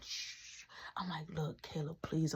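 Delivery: unvoiced hushed please; whispered plea. Whispering plea to brother. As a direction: beg quietly.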